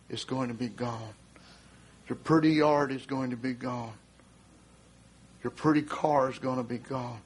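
low, measured warning; solemn preaching. Real, not acted. Preacher warns congregation of the coming flood, solemn and low. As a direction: bring it down low and grave, with long pauses.

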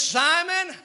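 high, ringing call of the name; calling Simon. Acted. Jesus addressing Simon the host, high ringing call. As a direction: call him out across the room.

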